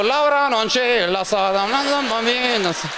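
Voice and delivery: panicked Italian babble. Retold or imitated. Gangster fleeing in panic, mock Italian babble.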